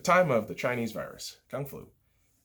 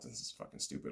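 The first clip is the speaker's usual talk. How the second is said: much softer, a breathy whispered aside; whispered thought; 18 dB quieter than usual. Retold or imitated. Reviewer whispering his old thought about the signings, hushed.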